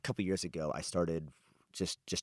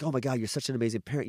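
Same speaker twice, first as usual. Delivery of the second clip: mock gushing; 6 semitones higher, 5 dB louder, faster than usual. Mocking what people gush at him.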